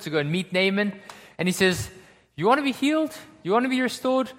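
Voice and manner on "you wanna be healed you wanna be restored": raised, rising questions; high-pitched offer. Acted. Servant offering a sick commander healing, high questioning pitch. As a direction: toss out two high, inviting questions. Messenger voiced offering Naaman a cure.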